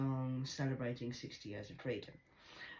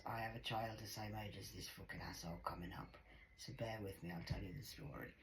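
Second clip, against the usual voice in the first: confiding whisper; 5 semitones lower, 12 dB quieter than usual. Real, not acted. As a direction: lean in and whisper.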